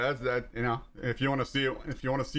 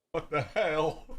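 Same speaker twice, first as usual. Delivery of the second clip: laughs through the question; disbelieving laugh in the voice; 3 semitones higher, slower than usual. Real, not acted. Laughing at what he just walked into.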